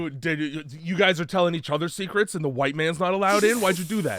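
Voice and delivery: high, loud accusing rant; hammed-up offended questioning; mock indignation. Comedian playing the man shut out of secrets, mock indignant. Acts out the offended movie husband.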